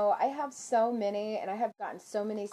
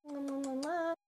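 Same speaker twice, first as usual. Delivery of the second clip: kiss sounds; 5 semitones higher, 4 dB quieter than usual. Real, not acted. Blowing kisses to viewers.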